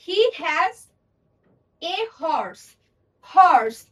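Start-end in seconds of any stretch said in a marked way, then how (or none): 1.8-3.8 horse with a clear h, louder; sounded h. Shown as a demonstration. Teacher contrasting a sounded h, horse. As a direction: punch the h in horse. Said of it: "এইচ উচ্চারণ করছি".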